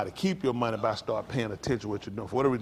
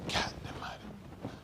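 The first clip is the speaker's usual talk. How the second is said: slow, breathless whispered "God damn it"; a hushed, drawn-out swear; whispered curse; 9 semitones higher, 14 dB quieter, much slower than usual. Retold or imitated. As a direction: curse it under your breath, slowly. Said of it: "I said".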